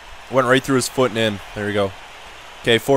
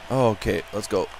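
normal talking voice

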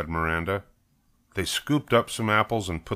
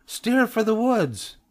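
very high, stretched-out call; urgent cry; slower than usual. A narrator's reading. Cub directs friend away from wet cement, urgent. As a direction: call the escape route urgently. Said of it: "cried Sister".

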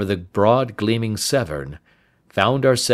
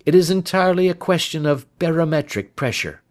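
quick, higher-pitched detective voice; lightly voiced cool sleuth; matter-of-fact Holmes; 9 semitones higher, faster than usual. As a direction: toss off the explanation briskly. Narrator voices Holmes replying.

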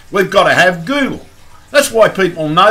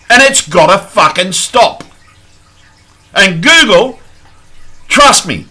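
real angry rant, raised and forceful; angry yelling; 3 semitones higher, 4 dB louder than usual. Real, not acted. Vlogger warning Google he will sue over spying, angry yelling. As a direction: yell it out, hitting the swear words hard. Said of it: "for yelling".

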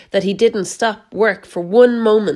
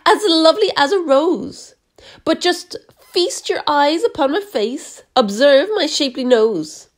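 higher, preening boastful aunt voice; vain Aunt Sponge; 6 semitones higher than usual. A narrator's reading. Aunt Sponge boasting to Spiker, higher vain voice. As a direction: show off her looks, all vanity. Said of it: "Aunt Sponge declared".

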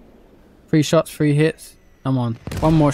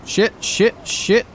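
panicked cursing; 7 semitones higher, much slower than usual. Real, not acted. Taking fire, panicking.

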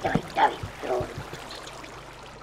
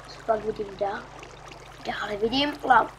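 ordinary talk, nothing marked

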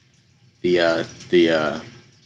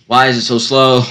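louder, exasperated repeat; 4 semitones higher, 8 dB louder than usual. Real, not acted. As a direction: raise it in frustration.

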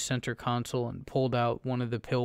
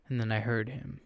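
ordinary delivery

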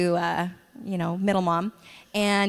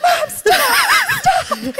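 put-on much higher and louder, laughing through a child's panicked shout; laughing imitation of a scared son yelling at his mom; retold kid yelling; 8 dB louder, much slower than usual. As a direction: squeal it like a kid being chased, half laughing. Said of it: "He's yelling".

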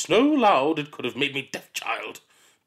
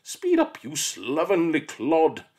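much higher, loud, slow bark; shouted scold; 6 semitones higher, 5 dB louder, slower than usual. Acted. Miss Seeth shouts at the slow child, barking. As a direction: bark at the dawdler.

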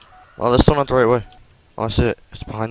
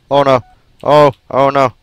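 panicked shout; 6 semitones higher, 15 dB louder than usual. Real, not acted. Chased by monster in game.

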